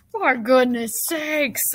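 slow, drawn-out exasperated bellow. A narrator's reading. Drenched Zuckerman to Lurvy, slow bellow. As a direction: stretch each word as a moderate bellow. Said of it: "bellowed Zuckerman".

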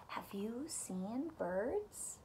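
soft, slow whispered question; whispered refrain. Acted. Reading the refrain to children, slow whisper. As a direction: breathe the refrain out gently.

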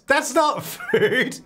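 high, drawn-out indignant cry with a laugh; indignant protest. Pretend. Player as toddler refuses potato chips, high indignant wail.

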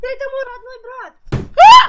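loud, stunned cry of disbelief; shocked shout. Real, not acted. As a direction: blurt it out in shock. Shocked to see her brother.

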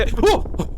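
높게 튀는 짧은 비명; 깜짝 놀라 터지는 소리; 놀란 외마디. Real, not acted. 갑자기 놀람. (화들짝 놀란 외마디 비명)

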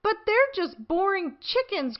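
put-on high whiny little-girl voice; Peppa whining. A narrator's reading. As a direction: complain like a bored small child. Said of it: "says Peppa".

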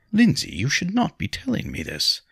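high-pitched, taken aback; surprised. A narrator's reading. Nobleman hearing a wife's complaints, surprised. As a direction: reprove her in evident surprise. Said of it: "The surprise was evident in his voice".

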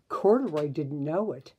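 ordinary delivery

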